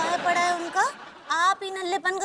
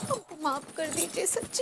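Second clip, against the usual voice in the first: soft mock apology; 3 semitones lower, 7 dB quieter than usual. Leaving in mock sorrow.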